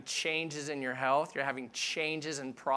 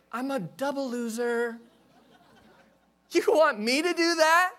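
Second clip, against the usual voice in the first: high, pleading, insecure voice; high pleading protest; anxious Gideon; 8 semitones higher, 5 dB louder than usual. Retold or imitated. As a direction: whine it like a nervous man begging off. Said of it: "Look what he says".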